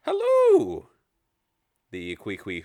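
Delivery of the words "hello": very high, loud chirpy owl greeting; owl's hello. Acted. Sparks the owl greets the party with a hoot. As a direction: squeak it out like a tiny owl. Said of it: "owl looks at you and says".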